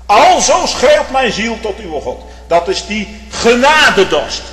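loud, high fervent preaching; impassioned sermon climax; preaching at full force. Acted. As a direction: thunder it from the pulpit.

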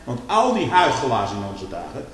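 loud, high, rising sermon fervour; fiery preaching. Acted. Preacher warning his congregation, preaching at full force. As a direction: thunder it from the pulpit.

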